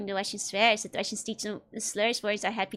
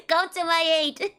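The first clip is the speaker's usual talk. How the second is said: mock plea for help; 6 semitones higher than usual. Mock plea to Russian chatters.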